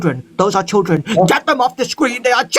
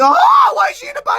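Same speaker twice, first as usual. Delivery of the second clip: shocked high outcry. Real, not acted. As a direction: cry out in disbelief. Shocked at balaclava on live.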